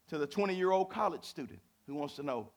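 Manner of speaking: ordinary talk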